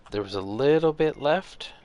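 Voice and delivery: bursting with excitement; excited. Real, not acted. Excited at shot.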